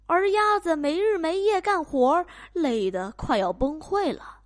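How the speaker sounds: usual speaking voice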